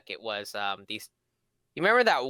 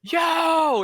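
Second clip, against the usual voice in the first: long, high, loud mock holler; alarmed shout; 7 dB louder than usual. Man seeing his car get ticketed, loud alarmed yell. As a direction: yell it to stop someone from afar.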